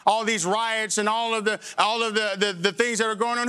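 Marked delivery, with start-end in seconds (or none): none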